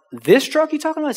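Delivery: high-pitched, astonished double-check; incredulous question. Retold or imitated. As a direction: pitch shoots up as if you can't believe the offer. Buyer stunned by the offer.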